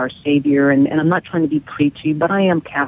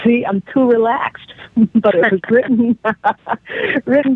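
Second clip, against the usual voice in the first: laughing; 7 semitones higher than usual. Real, not acted. Laughing at own slip-up.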